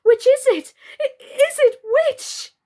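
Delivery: very high, loud, muddled panic; bewildered cry. Acted. Birdie muddled by fear, high panicked cry. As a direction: squeak it in utter confusion. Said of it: "cried Birdie".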